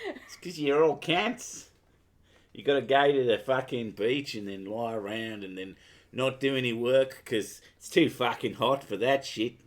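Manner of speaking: broad Aussie caricature; mock Australian accent. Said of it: "you do Aussie well".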